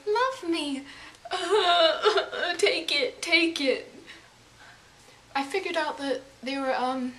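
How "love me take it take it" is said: lightly voiced cute animal begging, laughing midway; a little creature pleading to be loved, giggling. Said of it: "it's like".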